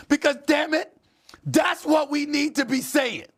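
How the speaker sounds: high-pitched, emphatic outburst; worked-up and insistent; impassioned agreement. Real, not acted. Emotional host seconds the rallying speech.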